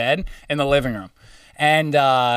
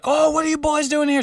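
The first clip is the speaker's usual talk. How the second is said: high mock old-lady voice; a scolding old woman startled by strangers; 12 semitones higher than usual. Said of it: "they're like".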